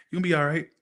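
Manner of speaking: ordinary delivery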